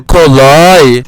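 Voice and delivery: loud, drawn-out gloating yell; triumphant shout. Real, not acted. Winning a fight on his birthday, triumphant loud yell. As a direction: belt it out after the win. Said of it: "Doğum günümde kolay diyorum".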